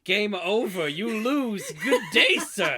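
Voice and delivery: loud, high, haughty brush-off; mock dismissal. Cohost joking at the kids, loud and haughty. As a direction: dismiss him grandly, laughing.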